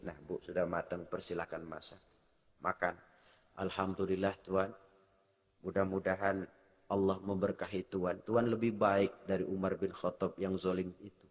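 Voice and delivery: sangat pelan dan lirih, lebih rendah; bisikan lirih. Acted. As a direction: bawakan adegan ini dengan lirih menyentuh. Adegan dibawakan sangat pelan. (penceramah membawakan adegan haru dengan suara lirih)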